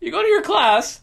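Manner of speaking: Jerry Seinfeld. Shown as a demonstration. Doing a Seinfeld bit for the podcast, high comic voice. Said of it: "That's my Jerry Seinfeld impression".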